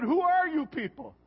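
pitched well up in startled surprise; surprised question. Retold or imitated. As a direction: ask it like you can't believe the crowd.

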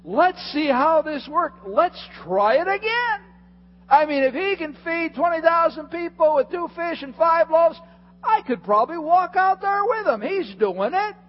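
high, loud, excited reasoning; eager Peter. Retold or imitated. Preacher voicing Peter's eager plan to the congregation. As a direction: talk yourself into it eagerly. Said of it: "And he says".

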